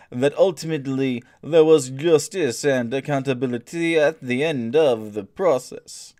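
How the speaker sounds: put-on a streamer reading an activist's quote in a silly French accent; outrageous French accent. Ham up the quote in a thick French accent. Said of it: "in an outrageous French accent".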